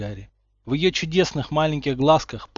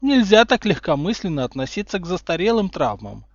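sly smile; 6 semitones higher, 5 dB louder than usual. A narrator's reading. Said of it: "ехидно улыбаясь".

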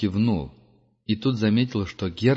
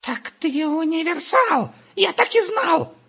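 high, slow squeaky triumphant cry; excited shout; 3 dB louder, slower than usual. Acted. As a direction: crow it out in a squeaky voice. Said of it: "закричал Густав".

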